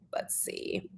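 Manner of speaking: under the breath, to herself; whispered let's see; whispered aside. Real, not acted. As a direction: mutter it while you look. Muttering while checking tabs.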